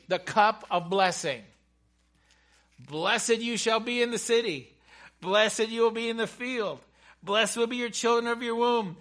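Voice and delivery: loud, high declaimed list of blessings; scripture proclamation. Acted. Preacher reading Deuteronomy's blessings to the congregation, loudly proclaiming. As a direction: proclaim each blessing at full preaching force. Reads Moses' blessing aloud.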